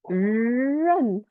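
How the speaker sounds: 认 with a long drawn-out curled R at the start; curled-tongue R. Shown as a demonstration. Teacher showing tongue position for R, drawn-out curled R. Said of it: "curve your tongue a bit".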